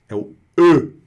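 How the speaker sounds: rounded E demo; French rounded e. Shown as a demonstration. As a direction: model the rounded E clearly. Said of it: "Pensa nessa embocadura do O".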